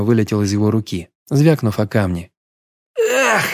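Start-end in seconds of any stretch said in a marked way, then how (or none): 3.0-3.4 sharp high cry of pain; pained howl. A narrator's reading. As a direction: yelp as the hand is hit. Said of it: "взвыл он".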